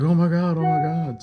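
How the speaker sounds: shocked gasp. Retold or imitated. Clerk seeing the chainsaw wound, high shocked alarm. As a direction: panic as it sinks in. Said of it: "He's like".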